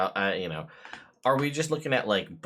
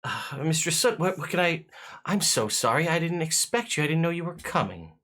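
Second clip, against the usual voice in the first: higher-pitched, apologetic servant; flustered footman; 8 semitones higher, faster than usual. Acted. Footman caught unprepared by Mistress Soot's arrival, flustered. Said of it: "and be like".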